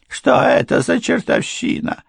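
very high, indignant question; shaken wife. A narrator's reading. Wife questions husband about madman, high startled demand.

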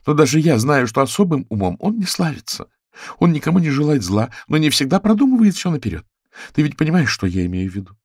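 high, lively character voice; chatty raccoon woman, defensive. Acted. Raccoon defends her lover to a reporter, high and earnest.

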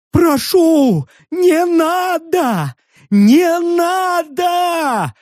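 high, long-drawn pleading scream; panicked wail. A narrator's reading. Hidden prism begs from the treetop, panicked wail. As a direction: wail it out high, stretching the vowels. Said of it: "завопил из трясущейся древесной кроны".

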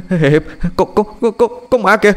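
high, stammering terror; stuttering in high terror at a ghost; terrified stutter. A narrator's reading. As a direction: stutter out each word, frozen with fear. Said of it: "lẫy bẫy lên từng tiếng như đang tập nói".

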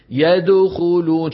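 يدخلون with rounded lips on the د; faulty يدخلون, د bounced toward u; wrong qalqala demo. Shown as a demonstration. Teacher showing the class the mistake. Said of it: "شوفوا الغلط".

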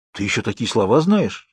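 plain reading voice

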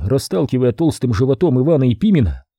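ordinary delivery

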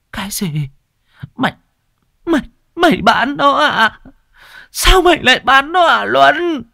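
high, loud crying wail; helpless wail. Acted. Old mother learns son sold her dog, crying out high. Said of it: "gào lên trong sự bất lực".

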